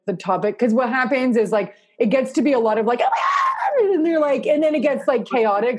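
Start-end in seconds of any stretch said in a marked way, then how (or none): 3.0-3.8 mock shout. Group members getting chaotic.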